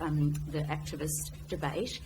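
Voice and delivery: usual speaking voice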